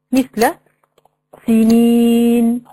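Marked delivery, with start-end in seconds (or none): 1.5-2.6 سنين with the ee held very long and level; drawn-out long vowel. Shown as a demonstration. Showing the ya madd to students, vowel lengthened. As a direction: stretch the ya in سنين on one note. Example word with prolonged madd.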